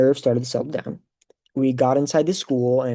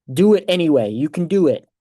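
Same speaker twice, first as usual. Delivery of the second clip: louder, higher urging; emphatic encouragement; 4 semitones higher, 6 dB louder than usual. Retold or imitated. Family cheering on a nervous graduate, emphatic encouragement. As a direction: push them on with a rousing pep talk.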